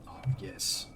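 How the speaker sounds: soft relieved whisper. Real, not acted. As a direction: breathe it out in relief.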